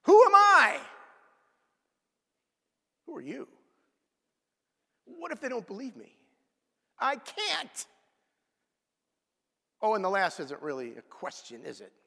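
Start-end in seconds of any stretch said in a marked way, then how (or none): 0.0-0.8 high loud cry of Moses' doubt; anguished Moses. Acted. 3.0-7.8 doubting Moses. Acted. Voicing Moses' questions.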